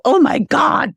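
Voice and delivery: mock-amazed, pitched high; high-pitched acted astonishment; shocked exclamation. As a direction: exclaim it like a big reveal. Exclaimed example of shocking moments.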